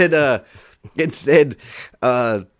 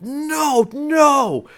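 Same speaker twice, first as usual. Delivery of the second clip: repeated high, loud yells of disbelief; imitated freak-out; 10 dB louder than usual. Drunk friend hears the old set list, freaking out. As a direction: cry out as if your mind is blown. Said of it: "he just starts freaking out".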